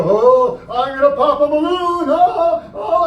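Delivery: loud, hammed-up, slow fake excitement; mock boring showman. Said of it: "that would be boring".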